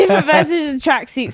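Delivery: tickled, laughing repetition; laughing echo. Retold or imitated. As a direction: laugh while echoing the phrase. Repeats his phrase back, laughing.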